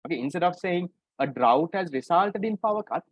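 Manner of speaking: ordinary talk, nothing marked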